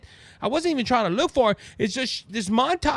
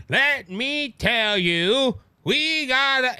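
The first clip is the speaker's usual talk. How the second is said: slow, drawn-out robot delivery; mock robot reading a teleprompter; robotic politician; 4 semitones higher, much slower than usual. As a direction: drag each word like a stiff, rehearsed speech. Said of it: "sounded like she's on a robot".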